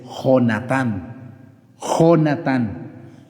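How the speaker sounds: "Jonatán" very slowly, with throaty J; correct Spanish J. Shown as a demonstration. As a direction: draw out "Jonatán" as ho-na-TAN. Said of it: "la pronunciación correcta de la letra J".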